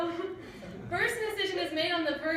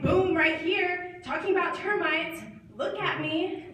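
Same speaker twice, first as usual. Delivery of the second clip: louder, punchy insistence; demanding attention; 2 semitones higher, 4 dB louder, slower than usual. Acted. Girlfriend demanding Caleb's attention mid-story, loud and insistent. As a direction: make him look right at you. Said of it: "I'm like".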